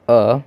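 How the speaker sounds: अ said as a short clear vowel; short a sound. Shown as a demonstration. Teacher showing students the short vowel sound अ. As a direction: voice the letter as अ, short and crisp. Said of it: "यहाँ पे हम इसे अ पढ़ रहे हैं".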